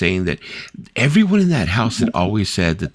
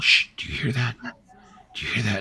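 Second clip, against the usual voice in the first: softer, hushed and slowing as she listens; hushed alarm; 5 semitones higher, 5 dB quieter than usual. Retold or imitated. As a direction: hush everyone and strain to listen. Girlfriend hearing noise at night.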